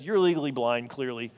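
low, deadpan brush-off; dismissive wife. Retold or imitated. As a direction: imitate an unimpressed wife, pitched well down. Wife flatly rejects the compliment.

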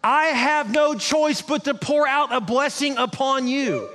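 high, slow, rising into a shout; booming divine proclamation, slow and high; voicing God, proclaiming. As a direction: declare it like God bound by his promise. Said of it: "He's like".

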